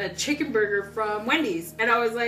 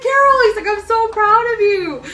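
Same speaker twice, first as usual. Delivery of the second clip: proud brother; 12 semitones higher, 13 dB louder than usual. Retold or imitated. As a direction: echo the brother's pride, glowing. Brother's proud words retold.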